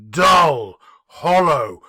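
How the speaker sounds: plain reading voice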